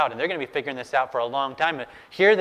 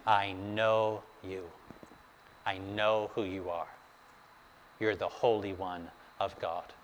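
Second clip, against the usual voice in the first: hushed recognition; 5 semitones lower, 8 dB quieter than usual. Acted. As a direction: say it softly, as a dreaded realization. Demon awed, recognizing who Jesus is.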